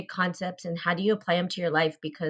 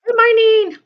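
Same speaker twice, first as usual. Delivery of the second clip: good morning sung out much higher and louder; excited greeting; 13 dB louder than usual. Real, not acted. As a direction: call it out high and loud to welcome her. Host greets guest live.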